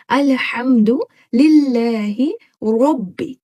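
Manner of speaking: slow recitation, heavy ha in अल्हम्दु, light in लिल्लाहि; slow careful recitation. Shown as a demonstration. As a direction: recite slowly, each ha distinct. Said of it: "ये दोनों different हा हो गए". (धीमी साफ़ तिलावत)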